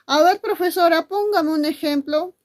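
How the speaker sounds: high, loud student voice; requesting pupil. Acted. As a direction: call on the teacher as a pupil.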